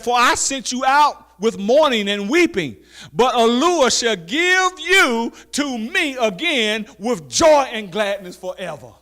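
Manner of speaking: high, loud, slow preacher's call; preaching scripture. Acted. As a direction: proclaim it to the congregation. Scripture read at full force.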